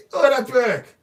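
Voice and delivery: high, loud incredulous question. Real, not acted. Speaker asks the audience about the MP's excuse, loud and incredulous. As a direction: demand it in disbelief.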